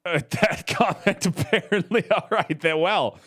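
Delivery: laughing. Real, not acted. Player laughs at comment.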